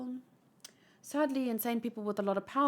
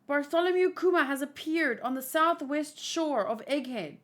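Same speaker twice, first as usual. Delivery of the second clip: raised, emphatic alarm report; higher-pitched, pressing lookout's report; urgent report; 4 semitones higher than usual. Acted. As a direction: announce an alarming sighting with rising urgency. Urgent report in story read aloud.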